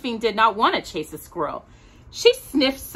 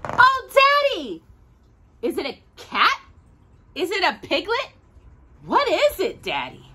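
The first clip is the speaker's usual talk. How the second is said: put-on much higher, excited little girl voice; little girl Daisy; 9 semitones higher than usual. A narrator's reading. Daisy meeting the funny puppy, high and thrilled. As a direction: squeal with wonder at the strange puppy.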